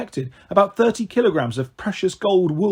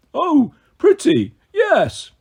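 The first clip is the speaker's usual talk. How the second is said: put-on childlike delight; 11 semitones higher, 4 dB louder, much slower than usual. A narrator's reading. Tyson admiring the golden fleece.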